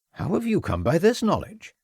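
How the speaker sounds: polite, slightly guarded headmaster; Welsh headmaster. A narrator's reading. Headmaster receiving the inspector, light Welsh voice. Edwards wary.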